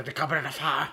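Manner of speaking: mock-frantic Dumbledore, words tumbling together; agitated Dumbledore. Mocking how the film delivers the book's line, hammed-up agitation. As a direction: blurt it out as a hammed-up, agitated wizard. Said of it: "Dumbledore asked calmly".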